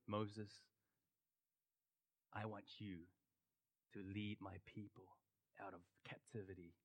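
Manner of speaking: God calling Moses in a hushed low voice. Retold or imitated. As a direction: speak as God, low and soft. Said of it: "God spoke and told him".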